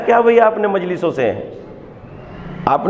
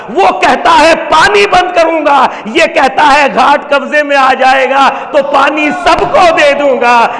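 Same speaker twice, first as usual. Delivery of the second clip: loud, high Urdu majlis preaching; preaching at full force; 6 semitones higher, 5 dB louder than usual. Acted. Preacher to mourners, loud fervent sermon. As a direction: drive the sermon home to the crowd.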